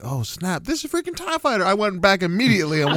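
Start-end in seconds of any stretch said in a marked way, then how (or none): 0.0-1.6 high, surprised realization; suddenly recognize it. Retold or imitated. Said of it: "and then I'm like".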